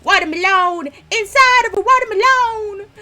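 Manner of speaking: high and loud, delighted; giddy game discovery; excited squeal. Real, not acted. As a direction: squeal with glee at the find.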